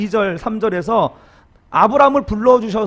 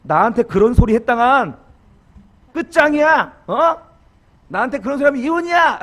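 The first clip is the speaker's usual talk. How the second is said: raised, higher half-joking threat; mock threat; 5 semitones higher, 5 dB louder than usual. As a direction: threaten the spouse, hammed up and loud. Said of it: "자, 위협". (장난스럽게 과장한 위협)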